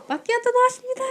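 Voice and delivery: high-pitched barista call-out; imitated barista shouting. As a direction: call out an order across a noisy café. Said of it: "소리 지르는데".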